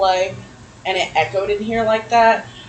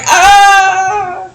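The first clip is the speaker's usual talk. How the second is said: mock weak scream; 11 semitones higher, 8 dB louder than usual. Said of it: "He's normally like".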